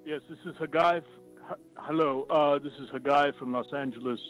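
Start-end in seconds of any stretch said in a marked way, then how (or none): none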